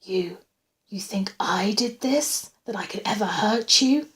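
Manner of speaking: ordinary delivery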